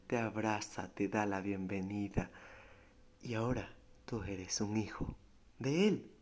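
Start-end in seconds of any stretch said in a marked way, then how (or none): none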